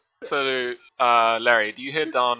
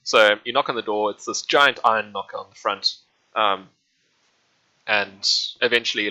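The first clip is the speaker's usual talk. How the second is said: usual speaking voice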